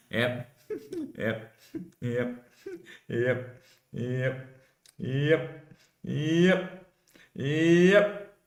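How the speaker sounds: repeated word creeping up in pitch and volume; building repetition. Real, not acted. Same word repeated, steadily building.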